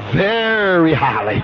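VERY drawn out long and slow; emphatic stretch. Real, not acted. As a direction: stretch VERY out before "highly". Preacher stresses the scripture's degree.

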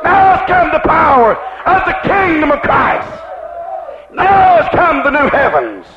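fiery revival preacher crying out the voice's message; full-force preaching. Acted. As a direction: build the proclamation up, hammering every Now.

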